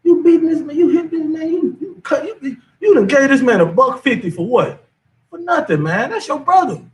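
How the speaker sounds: high, exasperated pleading; voicing the man. Preacher voicing a man scolding a fighter, high and pleading. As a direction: plead with him to stop fighting his brother. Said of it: "He asking the man".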